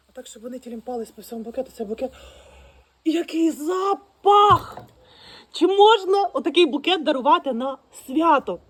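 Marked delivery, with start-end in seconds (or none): 3.0-8.6 loud, slow, thrilled exclamation; excited exclaiming. Real, not acted. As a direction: show off the bouquet with delight. Thrilled at her bouquet. (захоплено, голосно вигукує)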